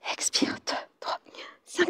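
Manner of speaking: very soft, whispered beats; whispered count. Real, not acted. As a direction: breathe out the numbers. Counting breaths during the exercise.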